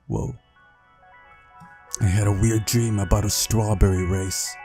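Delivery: ordinary talk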